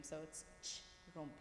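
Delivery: ch rompi with the ch on its own; ch set apart as its own sound. Shown as a demonstration. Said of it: "ch is such a strong letter".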